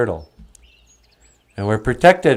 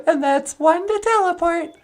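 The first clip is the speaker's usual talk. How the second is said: very high, bright greeting; a cheerful high puppet hello; [name]'s puppet voice; 3 dB quieter than usual. Acted. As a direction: pitch way up for the puppet.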